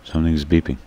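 ordinary delivery

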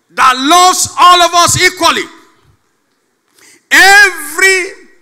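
loud, high preaching; preaching at full force. Acted. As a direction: proclaim it to the congregation.

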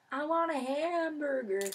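high, loud, drawn-out goofy voice; put-on silly high voice; silly in-joke voice. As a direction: belt it out slowly like a running gag. Reads out a friend's in-joke.

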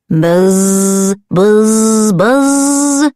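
low, drawn-out buzzing; buzzing bee. Acted. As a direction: buzz like a bee.

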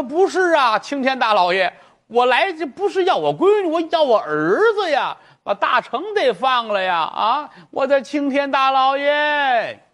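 put-on high, slow pleading old voice; old mother begging. Mother begs the magistrate to release her son, high-pitched. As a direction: beg for the son, drawn and high.

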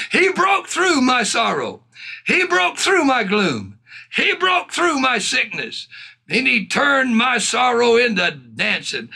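high, chant-like repeated proclamation; fervent preaching. Acted. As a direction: start each phrase high and let it fall. Preaches with fervour.

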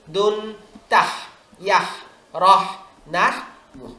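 slow, separate syllables, each clearly sounded; model syllable drill. Shown as a demonstration. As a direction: read each syllable slowly for the children. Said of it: "যেমন আলিফ লাম জবর". (ধীরে আলাদা আলাদা উচ্চারণ)

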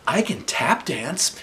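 high, slow sing-song boast; dreamy little brag; sweet teacher voice. A narrator's reading. Gifted teacher offering her talent, high slow sing-song.

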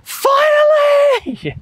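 very high, drawn-out yell of triumph; long high-pitched shout, imitating a child; imitated child's yell. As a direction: yell it like an elated kid, stretched out. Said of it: "she just yelled".

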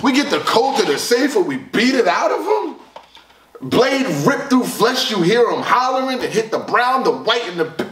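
high-pitched, astonished repeat; incredulous quoting. Retold or imitated. Reviewer repeating the rapper's bars, incredulous. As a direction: requote it as if you can't believe it.

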